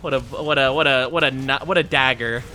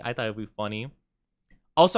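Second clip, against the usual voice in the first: ordinary talk, nothing marked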